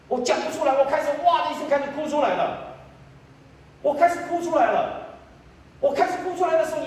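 high-pitched, driving preacher's retelling; impassioned testimony. Real, not acted. Preacher telling the congregation how everyone began crying, impassioned. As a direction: build the testimony in high, insistent waves.

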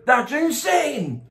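slow, high amazement; amazed. Real, not acted. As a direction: gasp it out in disbelief. Amazed at gift.

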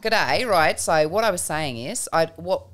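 casual, rambling Australian; mock Australian, halting and laid-back; Australian accent. Shown as a demonstration. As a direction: open like a chatty Aussie. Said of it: "I can only do Australian".

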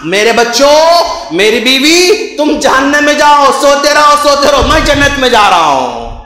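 mock proclamation to family. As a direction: declaim it as a scathing imitation. Mock farewell inside sermon. (व्यंग्य भरा जोशीला ऐलान)

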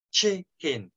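CHICKEN said "chee-keen"; two i sounds. Shown as a demonstration. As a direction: say "Chicken" with a clear i in both syllables. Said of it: "hai âm y này". ("Chicken" đọc rõ hai âm i)

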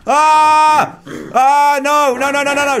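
high, loud screaming no no no; terrified cries; panicked scream. Real, not acted. Gamer overrun by zombies, screaming in panic. Zombies attacking, low health.

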